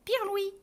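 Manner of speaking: high chirped swallow call. Acted. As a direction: answer with the bird's chirp. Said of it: "répond la clarinette". (gazouillis aigu imité)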